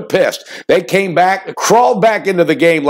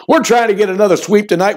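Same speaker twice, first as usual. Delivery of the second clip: usual speaking voice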